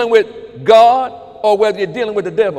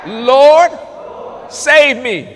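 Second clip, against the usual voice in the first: loud, drawn-out call for rescue; crying out; 2 semitones higher, 4 dB louder, much slower than usual. Shown as a demonstration. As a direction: call out to God at full voice. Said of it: "to cry out".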